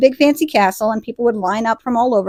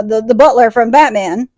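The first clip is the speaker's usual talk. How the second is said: usual speaking voice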